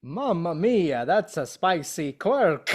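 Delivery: slow, hammed-up Italian caricature; mock-Italian cartoon accent. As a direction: draw it out in a jokey, over-the-top Italian accent. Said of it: "Italian tropes".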